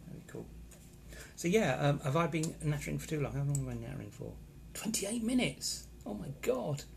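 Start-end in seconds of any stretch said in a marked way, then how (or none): 4.7-6.8 high-pitched, drawn-out astonishment; real surprise. Real, not acted. Streamer realising how long he has been nattering, astonished. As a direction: exclaim it high, genuinely taken aback.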